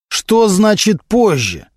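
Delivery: slow, high indignant question. A narrator's reading. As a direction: demand an explanation, drawn out. Prosecutor angered by the stalling.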